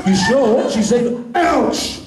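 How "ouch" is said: high, abrupt cry of pain; sudden high-pitched exclamation; sudden mock ouch. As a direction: yelp it as a trigger word. Fires the hypnotic trigger word.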